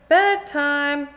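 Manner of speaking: loud bedtime call; mock call. Mother sending the child to bed, loud call.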